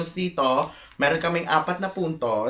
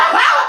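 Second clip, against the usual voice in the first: a sudden high loud laugh; burst of laughter; 10 dB louder than usual. Real, not acted. Explaining the rules to the group, a loud laugh.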